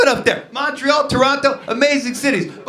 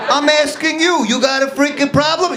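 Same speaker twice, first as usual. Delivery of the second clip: higher, louder, pushy; tough guy challenge; 9 semitones higher, 4 dB louder than usual. Retold or imitated. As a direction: start the argument. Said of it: "tough guy".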